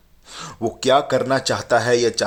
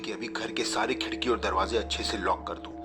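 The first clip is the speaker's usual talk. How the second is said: softer, pressing instructions; hushed urgency; 9 dB quieter than usual. Acted. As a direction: Kabir quietly telling Falak to lock up.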